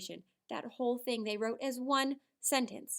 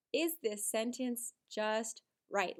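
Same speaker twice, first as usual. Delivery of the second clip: slow question; much slower than usual. Shown as a demonstration. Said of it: "ask yourself that question".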